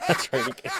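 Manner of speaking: high, breathy words through laughter; a high, breathy laugh over the praise. Real, not acted. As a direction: still laughing while praising.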